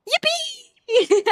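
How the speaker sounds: high, gleeful squeal of victory; excited cheer. Real, not acted. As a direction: celebrate with a high-pitched yippee. Celebrating a ban in game.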